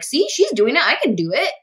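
high-pitched, excited acted thought; eager self-talk. Young movie fan spotting someone like her, eager self-talk. Acting out a hopeful viewer's thought.

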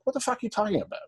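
ordinary talk

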